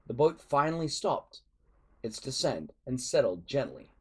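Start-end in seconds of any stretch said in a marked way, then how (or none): none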